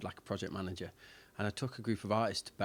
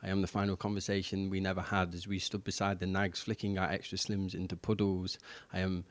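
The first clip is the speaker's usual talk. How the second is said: louder, steady spoken-word delivery; poem recitation; 7 dB louder than usual. Acted. Poet performs own poem for the host, spoken-word recitation.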